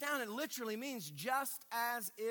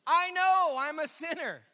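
loud, higher, playful confession; loud mock admission; 6 semitones higher, 9 dB louder than usual. Preacher answers congregation pointing fingers at him, loud mock admission. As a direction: own up to it at full voice.

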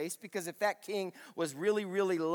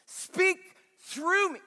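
urgent plea; 12 semitones higher, 5 dB louder, much slower than usual. Acted. Acted urgent prayer.

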